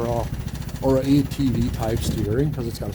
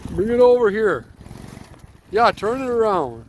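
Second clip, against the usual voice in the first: calling out; 9 semitones higher, 3 dB louder than usual. Real, not acted. Calling out to driver.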